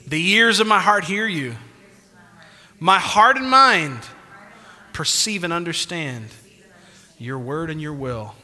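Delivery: loud, rising declaration with long pauses; confession of faith. Shown as a demonstration. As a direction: lead the church in a confession, phrase by phrase. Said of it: "Can you do this with me? Say".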